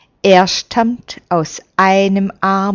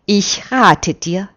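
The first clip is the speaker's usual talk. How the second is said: ordinary delivery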